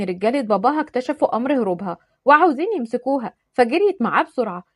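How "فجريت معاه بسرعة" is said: usual speaking voice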